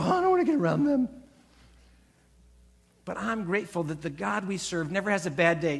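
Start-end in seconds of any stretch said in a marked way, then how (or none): 0.0-1.1 high, fast, fussy complaint; mock grumbling. Preacher mimicking people avoiding a grumpy friend, high and quick. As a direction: rattle it off like a fed-up gossip. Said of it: "And people go".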